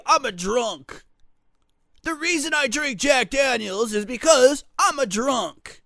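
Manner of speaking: lightly voiced bar drinker, drawn out; bar drunk. Host voicing a liquor drinker at the bar, mock drunk. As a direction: declare it as a proud drinker would.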